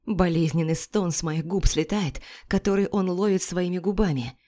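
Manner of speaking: usual speaking voice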